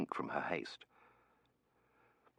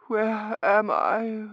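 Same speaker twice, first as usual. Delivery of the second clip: high, slow voice on coming round; faint; 7 dB louder, much slower than usual. A narrator's reading. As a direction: slowly come to, dazed. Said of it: "she said faintly".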